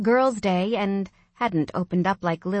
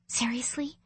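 hushed, disbelieving whisper; soft whispered aside; 4 semitones higher, 8 dB quieter than usual. A narrator's reading. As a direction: whisper it under your breath as a question. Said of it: "I whispered to him".

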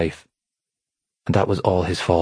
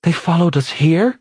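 high, louder alarmed disbelief; incredulous; 10 semitones higher, 4 dB louder than usual. A narrator's reading. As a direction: disbelief tipping into fear. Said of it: "sounding incredulous".

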